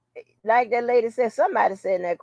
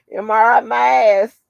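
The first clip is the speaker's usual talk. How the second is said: scoffing; 3 semitones higher, 7 dB louder than usual. Real, not acted. Mocking defendant's excuse.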